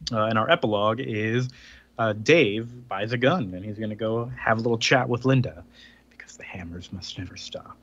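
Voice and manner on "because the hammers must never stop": breathy whisper, much softer, a creepy closing line; ominous whisper. Acted. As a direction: whisper it like a dark omen. Ominous epilogue punchline.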